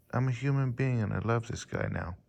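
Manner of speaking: ordinary delivery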